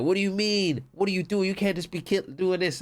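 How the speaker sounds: whiny indignant protest, pitched high. Retold or imitated. Retelling the protest of a stranger caught in a robbery, squeaky and indignant. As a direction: protest like a player wronged mid-robbery. Retold argument, other player protesting.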